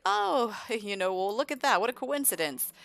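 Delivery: high, loud swoop on the oh; feigned surprise. Acted. Vlad caught in Caleb's house, mock surprised.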